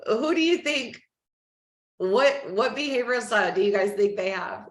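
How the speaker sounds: ordinary talk, nothing marked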